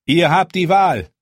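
loud, firm commander; commanding voice. A narrator's reading. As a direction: lay down the choice with authority. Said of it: "fuhr Jon Snow fort".

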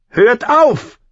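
put-on very high, loud girl's shout; scream. A narrator's reading. Arya interrupting the prince's attack, screamed. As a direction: scream for them to stop. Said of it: "schrie Arya".

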